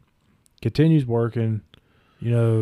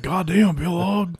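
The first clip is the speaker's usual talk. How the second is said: shocked curse; 7 semitones higher, slower than usual. Retold or imitated. Opponent in the ring reacting to the injury, high alarmed cry. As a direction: blurt it out in mock alarm. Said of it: "he's like".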